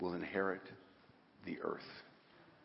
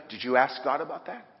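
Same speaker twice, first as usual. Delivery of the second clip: ordinary talk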